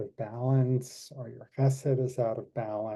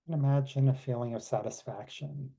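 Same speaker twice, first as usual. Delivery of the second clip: guided meditation. Acted. Leads closing meditation.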